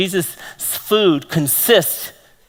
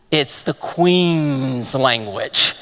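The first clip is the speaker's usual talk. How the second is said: slow, lower mock Queen's English; mock posh British English; 6 semitones lower, much slower than usual. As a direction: savour it like a grand British lady, slowly. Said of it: "But then there's the proper English".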